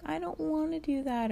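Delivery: high childish whine, mocking a kid; whiny kindergartner. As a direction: mimic a spoiled kid refusing. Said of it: "a kindergartner these days would be like".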